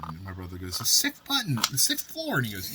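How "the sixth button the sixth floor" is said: high insistent. Retold or imitated. Drunk brother answering friend.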